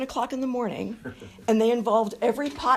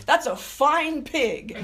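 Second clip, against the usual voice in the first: very slow, savoring repeat of Ted's line; a hint of Ted, drawn out; running-joke echo; 5 semitones higher, 4 dB louder, much slower than usual. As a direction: stretch "that's a fine pig" slowly, lightly voiced. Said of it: "I would say".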